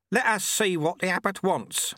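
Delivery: put-on high-pitched old sweeper; old sweeper-monk. A narrator's reading.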